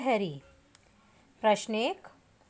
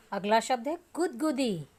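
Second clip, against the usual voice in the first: ordinary delivery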